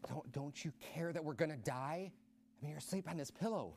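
soft, half-whispered complaint; hushed pleading. Acted. Disciples ask sleeping Jesus if he cares, hushed and pleading. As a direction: murmur it like a hurt friend.

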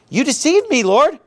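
upset, high-pitched accusation; Jeremiah. Acted. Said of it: "He's upset".

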